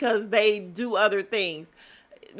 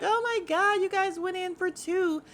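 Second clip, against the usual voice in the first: mock scandalized reaction; 11 semitones higher than usual. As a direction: imitate gushing onlookers, pitched way up. Acts out the ladies' reaction.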